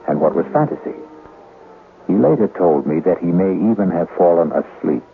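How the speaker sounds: usual speaking voice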